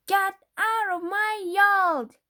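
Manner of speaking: high, loud, slow furious yell; angry sister shouting through the window. A narrator's reading. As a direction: chase the pig out of her yard. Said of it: "she shouted at Mercy".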